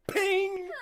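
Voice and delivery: sound effect. Acted. Force field impact sound.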